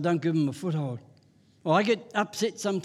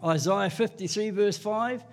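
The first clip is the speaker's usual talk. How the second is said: usual speaking voice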